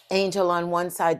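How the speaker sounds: normal talking voice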